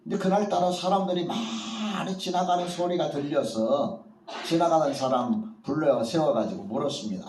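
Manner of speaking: ordinary talk, nothing marked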